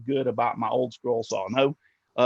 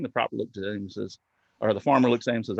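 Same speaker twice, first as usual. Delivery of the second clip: usual speaking voice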